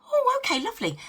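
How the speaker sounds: high startled oh, then dropping; a jolt of fake surprise; mock surprise. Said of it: "And I was like".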